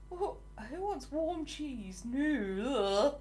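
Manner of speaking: slow, incredulous question ending in "ugh"; playful disgust. Real, not acted. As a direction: recoil from the very idea, drawing it out. Disgusted at idea of warm cheese.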